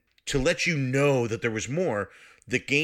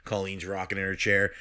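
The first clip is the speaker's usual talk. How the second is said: ordinary talk, nothing marked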